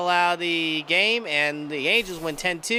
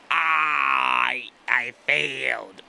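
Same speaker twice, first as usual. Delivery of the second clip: drawn-out groan, breaking into a yelled stammer; dismayed groan; 5 dB louder, much slower than usual. Real, not acted. Gamer groaning at a foul ball, dismayed. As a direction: sag as the hit goes foul.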